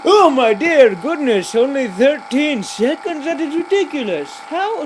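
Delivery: high, slow, laughing disbelief; hammed-up shock; mock disbelief. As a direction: play outraged astonishment. Shocked at only thirteen seconds.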